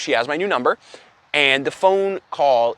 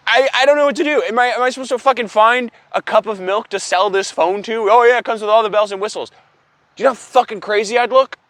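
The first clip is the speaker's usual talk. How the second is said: much higher and louder, near panic; frantic; 7 semitones higher, 4 dB louder than usual. Real, not acted. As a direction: blurt it out in exasperated disbelief. Rising panic over useless phone.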